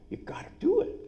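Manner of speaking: stage whisper, high and breathy; emphatic whisper. Acted. Coach urging the audience to hire one, whispered. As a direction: lean in and whisper it like a secret.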